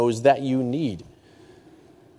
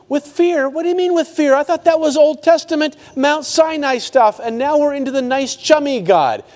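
much higher, incredulous mock objection; skeptical listener; 12 semitones higher, 4 dB louder than usual. Preacher voicing a doubting churchgoer, incredulous and high. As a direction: play the doubter who can't believe it.

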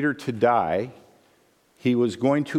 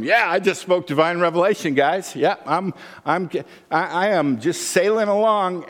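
smug Peter; 6 semitones higher than usual. Acted. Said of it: "You almost see Peter going from".

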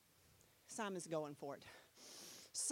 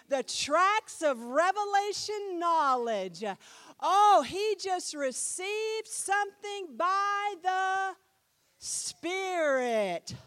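loud, slow, fervent preaching; impassioned loud sermon voice; full-force preaching; 5 semitones higher, 10 dB louder, slower than usual. Acted. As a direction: proclaim it to the whole church.

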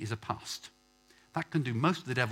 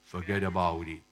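low mock Italian-gangster accent; terrible Italian accent; 5 semitones lower than usual. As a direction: do a bad Italian gangster, pitched down. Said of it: "In terrible Italian accent".

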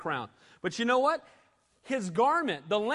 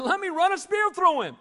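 much higher, louder keen plea; eager soldier; 11 semitones higher, 6 dB louder than usual. Retold or imitated. Abishai urging David to kill Saul, loud eager plea. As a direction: beg to strike while you can. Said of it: "Abishai said".

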